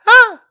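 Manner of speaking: very high, loud yelp of surprise; sudden shocked yelp; startled yelp. Retold or imitated. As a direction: yelp like you got shoved. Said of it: "I think I made this noise".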